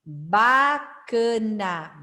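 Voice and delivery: Bacana drawn out slowly, syllable by syllable; slow model word. Shown as a demonstration.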